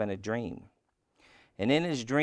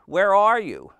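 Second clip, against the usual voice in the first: loud, high searching question; calling out; 9 semitones higher, 11 dB louder than usual. A narrator's reading. Toad searching for unseen Frog, calling out. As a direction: holler it out for a missing friend.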